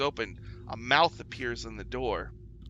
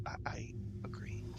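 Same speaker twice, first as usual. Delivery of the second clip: whispered agreement; 11 dB quieter than usual. Acted.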